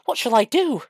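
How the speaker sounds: high, loud panicked wail. A narrator's reading. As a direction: wail it in helpless distress. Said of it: "he wailed".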